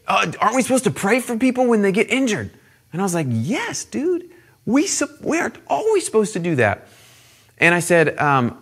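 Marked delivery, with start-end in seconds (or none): 0.1-2.5 higher, quick questioning voice; young boy asking. Retold or imitated. Acts boy questioning the leader. 3.3-6.9 high-pitched sheepish admission; caught-out agreement. Retold or imitated. Acts his caught-out agreement.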